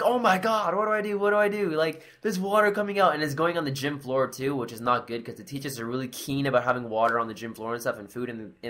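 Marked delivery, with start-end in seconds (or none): 0.0-3.1 high-pitched, flustered replay; acted panic. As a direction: fret helplessly, pitch held high. Replays own panic at the leak.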